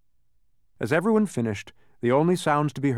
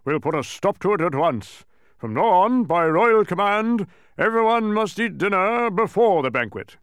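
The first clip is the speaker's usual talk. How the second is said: high, very loud command; indignant kingly proclamation; shouted royal decree; 10 semitones higher, 8 dB louder than usual. A narrator's reading. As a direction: thunder out a royal order. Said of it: "shouted the king".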